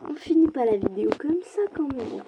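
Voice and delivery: indignation plus aiguë qui retombe; proteste, incrédule, d'une voix montée; protestation. Real, not acted. Proteste après la défaite. (refus plaintif, haut perché)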